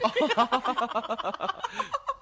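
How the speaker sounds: loud high mocking cackle; mocking laugh. Célimène mocking the prude behind her back, loud laughter. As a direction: laugh her rival to scorn.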